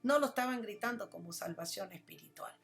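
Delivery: loud, rousing sermon delivery; full-voiced pulpit preaching; impassioned preaching. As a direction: preach it to the congregation with fire. Preaching on Hosanna.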